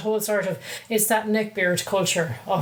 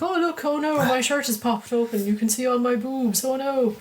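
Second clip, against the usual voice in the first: higher, girlish mock dismay; mock anime schoolgirl; 4 semitones higher than usual. Mocking anime fan service to partner, girlish anime voice. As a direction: parody a helpless anime teen girl. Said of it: "young teenage girls and in short skirts".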